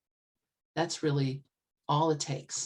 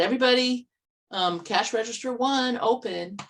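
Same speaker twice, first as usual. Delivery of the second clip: cashier calling out; 6 semitones higher, 4 dB louder than usual. Retold or imitated. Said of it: "he said".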